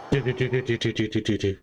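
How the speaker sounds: quick run of staccato "do-do-do"; imitated rapid-fire noise. Shown as a demonstration. Streamer illustrating all hell breaking loose, imitated rapid-fire noise. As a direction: sound out the storm breaking in rapid syllables. Imitates chaos after the calm.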